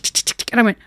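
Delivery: ordinary talk, nothing marked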